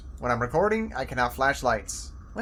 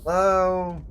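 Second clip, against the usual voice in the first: louder call down a hole; 3 semitones higher, 6 dB louder than usual. Real, not acted. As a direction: call into the dark. Calling down a snail hole.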